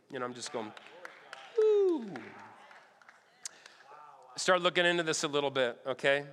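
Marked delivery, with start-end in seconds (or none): 1.6-2.1 mock cheer.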